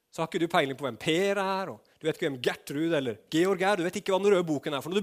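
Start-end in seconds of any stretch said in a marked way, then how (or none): none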